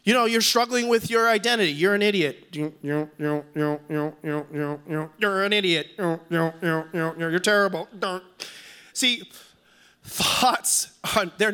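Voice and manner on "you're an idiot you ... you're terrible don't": low monotone chant on repeated "you", like a looping thought; mock accusing voice, droning round and round; nagging inner voice. Acting out boomeranging negative thoughts.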